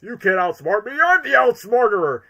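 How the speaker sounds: loud, high villain boast; hammy crowing, building to a shout; Eggman. Retold or imitated. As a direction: belt it out like a gloating cartoon villain. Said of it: "I just like the line delivery".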